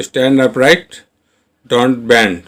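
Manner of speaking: very slow model. Shown as a demonstration. Teacher modelling stand upright for learners, very slow. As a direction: stretch stand upright out word by word. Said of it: "teacher models English sentence".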